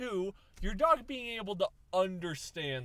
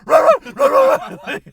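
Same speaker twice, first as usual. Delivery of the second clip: loud, high mock barking; high, very loud imitation of a dog barking back; 22 dB louder than usual. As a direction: bark it out at full volume. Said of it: "it's like".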